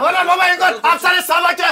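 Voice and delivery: loud, high pleading shout; shouting over the argument; frantic shout. Acted. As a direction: cry out to stop him. Frantic calling during the quarrel.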